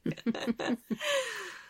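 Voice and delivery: high, loud real laugh; delighted real laughter; burst of laughter. Real, not acted. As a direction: crack up at the cat's interruption. Cat interrupts the reading.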